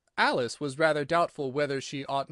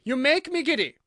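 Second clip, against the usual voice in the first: high, loud complaint; imperious high yell; screamed; 11 semitones higher, 8 dB louder than usual. A narrator's reading. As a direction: scream it like an irritated queen. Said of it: "screamed the Queen".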